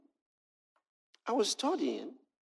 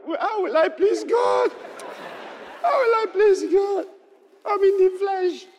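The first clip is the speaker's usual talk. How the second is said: tearful plea; 14 semitones higher, 4 dB louder than usual. Retold or imitated. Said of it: "Then maybe I started crying".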